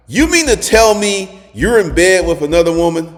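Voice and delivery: imitated indignant girlfriend, high and loud; pissed. Girlfriend confronting him about another woman, imitated pissed-off voice. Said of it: "Simone got pissed".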